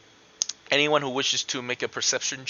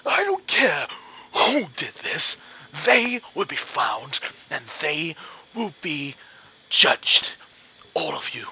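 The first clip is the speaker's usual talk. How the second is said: acted outraged shout, strained and emphatic; angry elf shouting; 3 semitones higher than usual. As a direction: play an enraged elf demanding justice from a crowd. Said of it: "is shouting at the top of his lungs".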